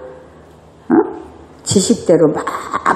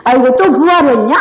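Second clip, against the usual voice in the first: higher, sarcastic jab; mock taunting spouse; 6 semitones higher, 3 dB louder than usual. One spouse taunting the other, sarcastic. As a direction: needle your spouse with sarcasm. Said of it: "막 서로 싸우는 거야".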